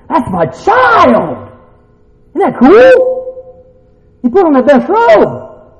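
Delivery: slow, high, loud proclamation; drawn-out, jubilant sermon shout; exultant preaching. Real, not acted. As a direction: stretch it out with triumph. Preacher builds to proud climax.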